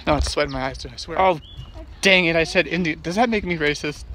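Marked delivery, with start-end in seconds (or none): none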